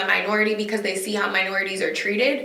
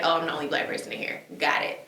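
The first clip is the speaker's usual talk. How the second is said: wry inner realization; 6 semitones lower, 8 dB quieter, faster than usual. Retold or imitated. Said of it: "I'll be like".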